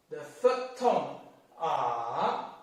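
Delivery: R dipping low, then back up; third tone R. Shown as a demonstration. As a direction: drop R down into a dip. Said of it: "Let me take R as an example".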